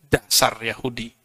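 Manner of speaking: low, soft mock insult; a hint of a muttered "Dasar Yahudi"; mock scornful mutter. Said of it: "Apakah Nabi marah". (ejekan pelan yang dibuat-buat)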